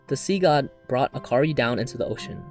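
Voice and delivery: ordinary talk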